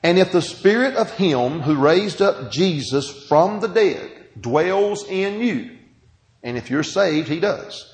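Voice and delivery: slow, deliberate scripture reading. Shown as a demonstration. Pastor reading Romans slowly to the congregation, deliberate. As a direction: read each word slowly so they listen. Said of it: "I'll read it slow".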